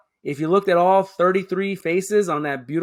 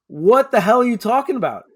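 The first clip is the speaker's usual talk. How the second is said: much higher and louder, incredulous; outraged exclamation; indignant outburst; 7 semitones higher, 6 dB louder than usual. Real, not acted. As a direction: throw it out in disbelief. Indignant outburst about being called brown.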